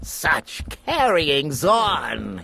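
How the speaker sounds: slow, sour grumbling in a character voice; disgusted mutter. A narrator's reading. Lord Droon sulking at the king, muttering in disgust. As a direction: drag the words out in distaste. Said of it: "he would mutter in disgust".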